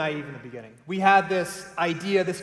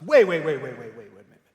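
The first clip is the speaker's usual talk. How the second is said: impatient interruption; 3 semitones lower, 5 dB louder, faster than usual. Retold or imitated. Host interrupts the speaker.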